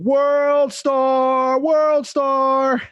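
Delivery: high, drawn-out holler; stretched-out mock hype shout; imitated yell. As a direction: yell it like a background heckler. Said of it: "in the background yelling".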